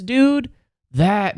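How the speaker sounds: usual speaking voice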